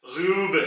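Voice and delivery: ग्यूब with a rounded, flattened ऊ; umlaut form. Shown as a demonstration. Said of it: "आवाज थोड़ी सी flat हो जाएगी". (ग्यूब में गोल होंठों वाली ऊ)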